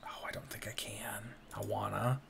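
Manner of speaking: hushed eager whisper. Real, not acted. Sneaking toward the birds, a soft whisper. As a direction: whisper it like a cat stalking birds.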